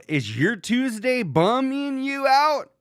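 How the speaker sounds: high, slow, drawn-out pitch; mock promo. Reading a mistimed tweet to followers, mock promo. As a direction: ask it like a cheesy advert.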